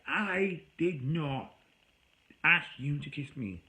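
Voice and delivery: slow, loud, every word spaced out; firm denial. Retold or imitated. Quoting his own reply to her, slow and emphatic. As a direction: spell it out word by word, firmly.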